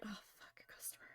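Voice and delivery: breathy whispered curse under the breath; a startled whispered oh fuck; hushed curse. Retold or imitated. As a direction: whisper it so the customer can't hear. Panicked aside as customer walks in.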